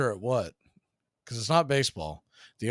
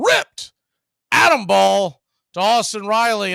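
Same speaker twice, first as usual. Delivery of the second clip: sudden excited burst on ripped, much higher and louder; excited home run call; 5 semitones higher, 7 dB louder than usual. Real, not acted. Radio announcer calling the hard-hit ball to listeners, excited burst.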